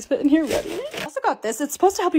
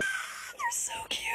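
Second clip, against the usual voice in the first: much higher, slow, breathless whisper; squeaky gushing whisper; 13 dB quieter, much slower than usual. Real, not acted. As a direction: squeal it under your breath. Gushing over new Jibbitz.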